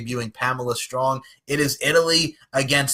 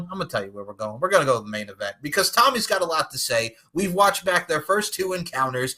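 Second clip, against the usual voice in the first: normal talking voice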